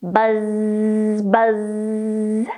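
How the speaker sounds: long droning buzz; buzzing bee. A narrator's reading. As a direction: hold the zz like a bee. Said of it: "said bee".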